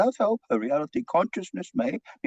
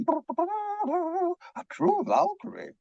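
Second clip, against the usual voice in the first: slow, higher, relishing Valkyrie; savouring admiration; 9 semitones higher, much slower than usual. Acted. Doctor musing about his patient, slow and relishing. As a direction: draw it out with awe.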